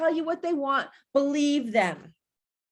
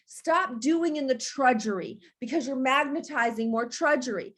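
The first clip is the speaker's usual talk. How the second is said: ordinary talk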